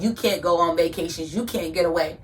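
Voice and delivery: ordinary delivery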